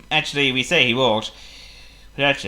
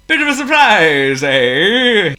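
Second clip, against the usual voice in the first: high, loud smug tease; pleased with himself; 7 semitones higher, 7 dB louder, slower than usual. Acted. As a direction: gloat cheerfully. Potto pleased.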